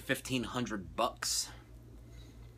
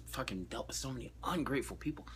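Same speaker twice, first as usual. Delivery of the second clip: whispered aside; 8 dB quieter than usual. Real, not acted. Muttered aside.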